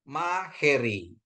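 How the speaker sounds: Maheri said slowly, each syllable clear; slow demo reading. Shown as a demonstration. Teacher models Maheri slowly for students. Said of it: "Jadi bacanya Maheri".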